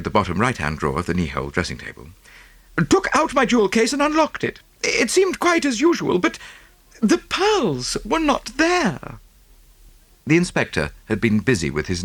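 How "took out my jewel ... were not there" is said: high, breathless socialite voice; gushing rich American lady. Acted. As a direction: pour it out like a fussy rich lady. Mrs Oppleson voiced.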